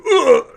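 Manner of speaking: loud, high "ugh" of impact; acted pained grunt. Fighter doubling over from a gut stab, acted grunt. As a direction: let out a winded grunt.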